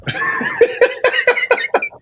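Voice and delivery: long, high-pitched laugh; sustained high giggling fit; real laughter. Real, not acted. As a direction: crack up at length. Laughing over terrible accent admission.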